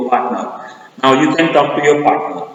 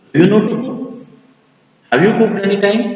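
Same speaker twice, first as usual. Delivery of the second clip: doubting partner; 7 semitones higher, much faster than usual. Acted.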